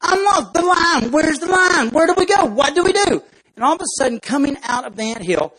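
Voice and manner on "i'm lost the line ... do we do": high, loud frantic crying; panicked ant. Acted. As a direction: wail it, lost and terrified. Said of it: "he completely freaks out".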